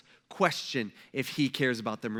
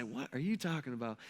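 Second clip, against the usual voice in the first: calm unbothered reply; 4 semitones lower, 9 dB quieter than usual. Acted. Calm Jesus answering panicked disciples.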